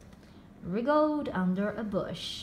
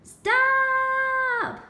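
much higher, louder sustained yell; shouting stop; 13 semitones higher, 9 dB louder than usual. A narrator's reading. Izzy halting the zoo chaos, full shout. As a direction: belt it at the top of her voice. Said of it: "shouted Izzy at the top of her voice".

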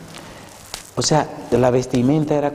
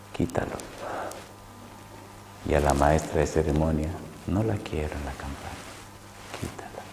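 quiet firm instructions; 4 semitones lower, 10 dB quieter than usual. Retold or imitated. God's instructions in dream.

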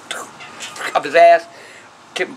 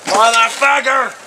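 angry curse; 3 semitones higher, 3 dB louder, slower than usual. Real, not acted. Garbage juice spills on him.

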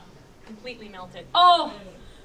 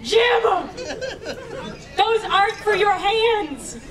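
higher, louder, slow scolding; laughing shout; 6 semitones higher, 5 dB louder, slower than usual. Real, not acted. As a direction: yell at Jim through laughter. Suiter grabbing his sock.

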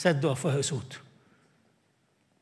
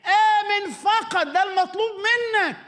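high, slow proclamation; 14 semitones higher, slower than usual. Acted. Preaching climax.

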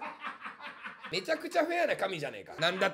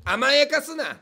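loud, higher comic shout; mock scolding; 6 semitones higher, 8 dB louder, slower than usual. Streamer telling off an indulgent viewer, loud mock scolding. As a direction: scold them at full voice for laughs.

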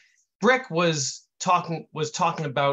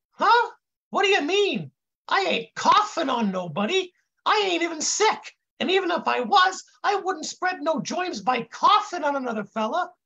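high, dim-witted intern protest; high kid voice; 14 semitones higher than usual. A narrator's reading. Confused kid denies coughing on anybody, high voice. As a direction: keep it way up, dopey and indignant. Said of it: "the kid says in this high voice".

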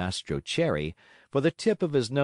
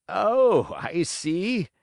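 put-on laughing old carpenter; 7 semitones higher, 4 dB louder, much slower than usual. A narrator's reading. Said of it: "laughing and scratching his wig".

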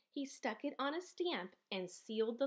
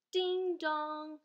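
sung-out doorbell chime, higher and louder; doorbell ding dong; 5 semitones higher, 4 dB louder than usual. A narrator's reading. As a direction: ring it out like a doorbell. Doorbell sound in story.

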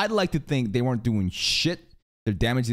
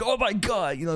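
surprised gasp; 10 semitones higher than usual. Retold or imitated. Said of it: "they're like".

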